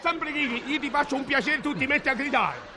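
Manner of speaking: high-pitched indignant yelling; comic duo shouting match; angry shouting. Acted. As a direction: shout back at her. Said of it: "tu non gridare con me".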